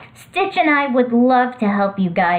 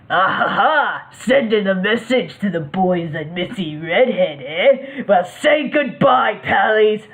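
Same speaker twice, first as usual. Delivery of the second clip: gloating villain. Acted. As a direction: gloat like a cartoon henchman.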